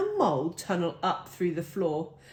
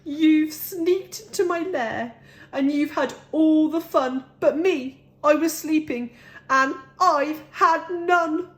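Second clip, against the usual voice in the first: higher, louder aggrieved complaint; upset bear; 8 semitones higher, 5 dB louder than usual. A narrator's reading. Bear telling his guests he missed the fun, higher louder hurt whine.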